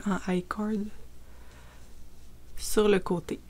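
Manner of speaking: murmured under her breath; half to yourself while showing the work; soft aside. Real, not acted. (murmuré en aparté)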